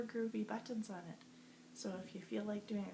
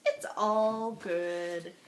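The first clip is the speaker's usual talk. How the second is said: cool cat voice; 4 semitones lower, 6 dB louder, much slower than usual. Acted. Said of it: "And he's saying".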